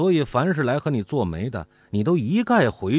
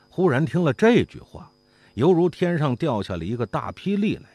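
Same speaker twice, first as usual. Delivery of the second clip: plain reading voice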